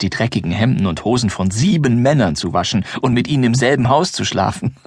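ordinary delivery

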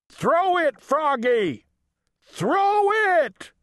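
high-pitched mock scream; screaming teammates. A narrator's reading. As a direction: scream it high, like a frantic team. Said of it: "screamed the Giants".

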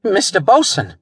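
high, flustered voice; stammered. A narrator's reading. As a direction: say it high, rattled and unsure. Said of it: "he stammered".

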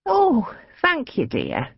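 put-on frail, kindly old woman; sweet elderly lady thanking.

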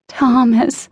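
high, drawn-out moan of a name; moaned plea. A narrator's reading. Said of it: "Ariana moaned".

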